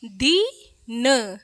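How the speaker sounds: दिन spelled out very slowly; slow teaching sound-out; slow syllables. Shown as a demonstration. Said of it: "क्या है?".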